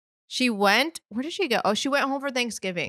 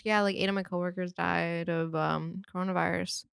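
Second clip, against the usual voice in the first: imitating an offhand dad, low-key and soft; deadpan, quiet, matter-of-fact delivery; no emotion; 3 semitones lower, 6 dB quieter than usual. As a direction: report deaths like routine news. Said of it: "like, no emotion".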